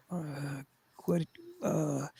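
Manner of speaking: soft, groggy mumble to oneself; barely audible, dazed and drowsy; half-asleep. Retold or imitated. Man squinting at the thermostat half asleep, groggy. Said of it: "trying to focus 'cause I'm asleep".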